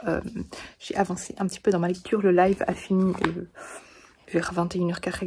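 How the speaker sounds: soft, hushed whisper; late-night whisper. Real, not acted. At eleven at night before sleep, hushed whisper to viewers. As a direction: whisper so as not to wake anyone.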